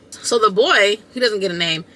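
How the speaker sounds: ordinary talk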